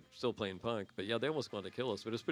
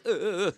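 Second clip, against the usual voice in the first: choking; 6 semitones higher, 6 dB louder than usual. Retold or imitated. Lifted off ground by neck.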